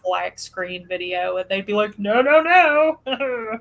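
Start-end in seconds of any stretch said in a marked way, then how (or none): 2.0-3.6 loud, high, drawn-out no; exaggerated scolding imitation of a rejection; mock refusal. Voices YouTube rejecting a blank video.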